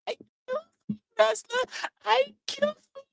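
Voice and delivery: high, soft, ghostly moaning; ghostly wail. Acted. The soulless woman haunting him, high ghostly moan. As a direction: moan it like a wandering lost soul. Victim's ghostly lament quoted.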